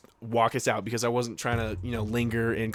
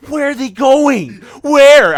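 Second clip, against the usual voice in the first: much higher, much louder, slow panicked cry; 13 semitones higher, 14 dB louder, much slower than usual. Retold or imitated. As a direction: call out in alarm, stretching it. Panicking about friends leaving.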